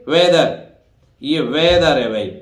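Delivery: usual speaking voice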